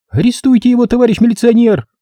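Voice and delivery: high-pitched, outraged squeal; screeching woman. Acted. Mother demands policeman arrest the man, high screeching. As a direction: shriek a complaint to the police. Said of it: "визжала меж тем баба".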